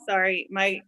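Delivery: usual speaking voice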